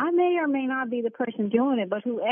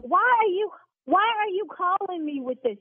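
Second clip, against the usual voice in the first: yelling; 7 semitones higher than usual. Real, not acted. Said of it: "See, now I'm yelling".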